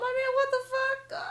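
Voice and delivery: very high, slow mock whine; exasperated disbelief. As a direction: whine it out, drawn long and high. Retells dismay at being pointed at.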